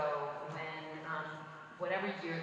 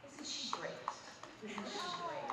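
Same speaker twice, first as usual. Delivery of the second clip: softly cooed imitation of a gracious compliment; lovely, gushing praise; 8 semitones higher, 6 dB quieter than usual. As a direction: coo it gently, lifting higher on the repeat. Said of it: "And she is so lovely".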